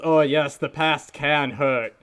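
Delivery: slow, mixed Rastafarian-German accent imitation; Rafiki. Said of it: "Rafiki voice".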